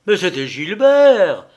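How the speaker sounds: loud, high exclamation; excited cry. A narrator's reading. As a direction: cry out suddenly in startled recognition. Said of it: "s'écria Stello en frappant des mains".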